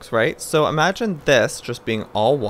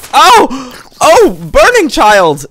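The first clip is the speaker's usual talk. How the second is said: loud, high alarmed yelling at a burning zombie; startled shouting; 7 semitones higher, 13 dB louder than usual. Real, not acted. Baby zombie on fire attacking.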